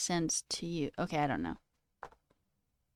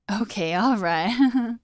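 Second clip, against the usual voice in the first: loud, slow, hammed-up catchphrase; self-parody, stretched and loud; mock catchphrase; 3 semitones higher, 7 dB louder, much slower than usual. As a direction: draw it out loudly, teasing your own habit. Parodying own overused catchphrases.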